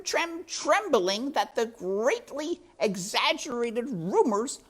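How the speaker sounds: high, purring villain rat, mockingly weary; sly rat voice. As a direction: play the sly rat toying with the mouse. Rat Botticelli's voice in story.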